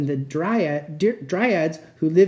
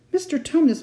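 high-pitched, exasperated call; bawling; 11 semitones higher, 3 dB louder than usual. A narrator's reading. Lucy in the sobbing fawn's ear, bawling. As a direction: call sharply to get through to him. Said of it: "bawled Lucy in his ear".

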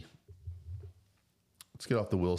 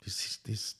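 unvoiced hushed whisper; 2 semitones higher, 6 dB quieter than usual. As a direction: breathe it out in a hush. Whispered aside to wife.

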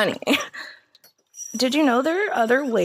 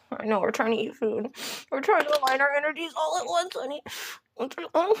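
ordinary talk, nothing marked

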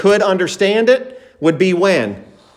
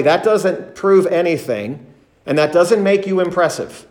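normal talking voice

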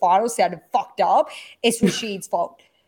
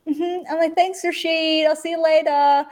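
stunned politeness; 8 semitones higher, slower than usual. Retold or imitated.

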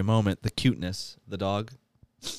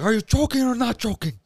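Al Pacino voice; 5 dB louder than usual. Acted. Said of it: "He talks like this, like Al Pacino".

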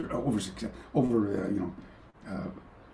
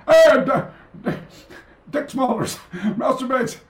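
higher, breaking into laughter on the names; laughing through it; 12 semitones higher, 4 dB louder, slower than usual. Retold or imitated. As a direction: giggle while announcing the names. Imagining the gaffe, laughing.

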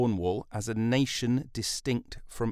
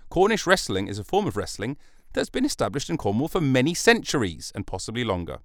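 usual speaking voice

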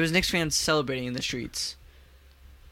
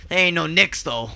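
cocky fan voice; 3 semitones higher, 5 dB louder than usual. Retold or imitated.

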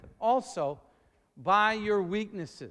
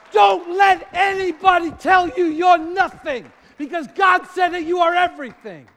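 higher, much louder fired-up preaching, shouted; preaching at full force; 8 semitones higher, 12 dB louder than usual. Acted. Pastor firing up the congregation about confidence from God, shouting. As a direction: preach it loud, rising to a shout.

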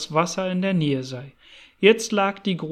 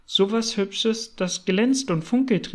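ordinary delivery

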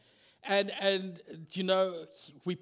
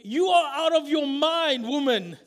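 scornful louder rebuke; 5 semitones higher, 8 dB louder than usual. Acted. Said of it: "they told her".

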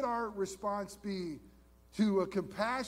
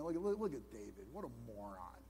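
scornful wife; 7 semitones lower, 7 dB quieter than usual. Retold or imitated. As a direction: belittle David like a disdainful queen. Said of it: "a wife ... saying".

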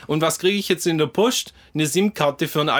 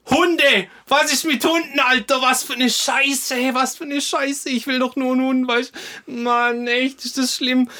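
rant, pitched way up; outraged rant; 8 semitones higher than usual. Real, not acted. Outraged rant about dogs.

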